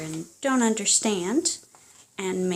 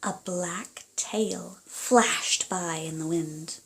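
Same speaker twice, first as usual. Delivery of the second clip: lively storybook reading aloud; reading to children; 2 semitones higher, 4 dB louder than usual. Acted. Parent reading a picture book to a child, animated storytelling. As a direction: read it like a bedtime story to little ones.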